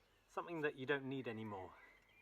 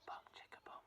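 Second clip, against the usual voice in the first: whisper; 14 dB quieter than usual. Shown as a demonstration. Children echo whispered.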